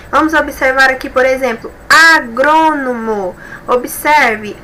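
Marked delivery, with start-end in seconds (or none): 1.9-3.4 a-GRÔ-no-mo, slow, every syllable stretched; stressed syllable drawn out. Shown as a demonstration. Teacher locating the strongest syllable of agrônomo, stressed syllable drawn out. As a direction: draw agrônomo out slowly, holding GRÔ. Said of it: "a sílaba mais forte".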